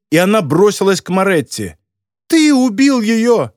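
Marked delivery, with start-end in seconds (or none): 2.3-3.5 high, drawn-out accusing cry; furious accusation. A narrator's reading. Girl rushes at the killer, furious accusation. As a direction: hurl it out, slow and high.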